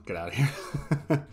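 slow retold brush-off breaking into laughter; laughing. Security guard lets a cheeky fan off, laughing. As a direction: laugh through a mock dismissal.

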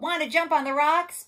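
high, loud, animated invitation; storybook goat. A narrator's reading. Goat asking spider to jump on rocks, high and loud. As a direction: pitch it up as an eager goat. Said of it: "said the goat".

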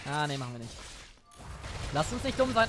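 usual speaking voice

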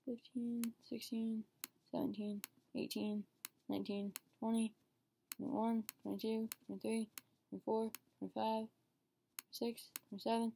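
soft, steady counting aloud; counting to oneself. Real, not acted. Counting days for viewers, quiet tallying. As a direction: count quietly, one number at a time.

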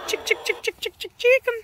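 high sing-song chick chick call; calling chickens. Real, not acted.

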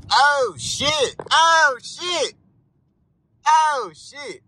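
high yelped oh shit, loud and sudden; startled shriek. Real, not acted. Driver bottoming out on bumps yelps in real alarm. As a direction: yelp as the car bottoms out. Low car hits Houston road bumps.

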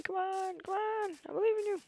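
high pleading encouragement; eager urging. Real, not acted. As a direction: cheer the progress bar along. Urging the upload bar on.

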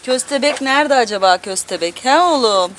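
normal talking voice

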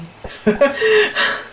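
a sudden high squeal of a laugh. Real, not acted. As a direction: burst into a squeaky giggle. Laughs at sponsorship joke.

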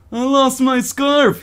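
high, drawn-out pitiful whine; hammed-up pathetic plea, very slow. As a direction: wail it pitifully, stretching the words. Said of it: "he says in a pitiful voice".

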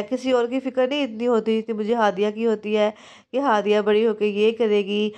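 ordinary delivery

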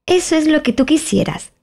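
high twin voice with great self-satisfaction; smug twin. Acted. Tweedledee mocking Alice, high and smug. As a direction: talk down to her, pleased with yourself.

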